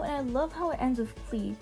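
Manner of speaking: ordinary talk, nothing marked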